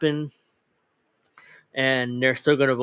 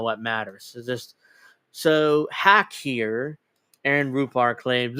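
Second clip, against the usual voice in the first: normal talking voice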